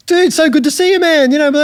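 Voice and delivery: high, loud mock-enthusiastic hello; gushing greeting. [name] greeting him like an old friend, gushing. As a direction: gush it like a long-lost pal.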